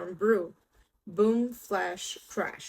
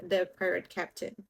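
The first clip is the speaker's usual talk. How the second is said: plain reading voice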